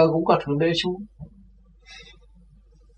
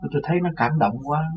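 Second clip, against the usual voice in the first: ordinary talk, nothing marked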